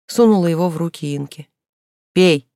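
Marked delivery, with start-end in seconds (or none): 2.1-2.5 loud curt order; commanding. A narrator's reading. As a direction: order her to drink. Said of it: "велела она". (громкий короткий приказ)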